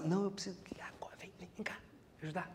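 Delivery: whispered, fast and pleading; urgent whisper. Acted. A friend at the party begging for help, whispering urgently. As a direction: hurriedly whisper the plea. Pushy friend pulling her aside.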